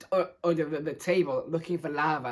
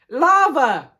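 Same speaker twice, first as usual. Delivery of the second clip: sudden loud, high yell; 9 semitones higher, 9 dB louder than usual. A narrator's reading. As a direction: yell the name out in alarm. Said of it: "I, I yelled".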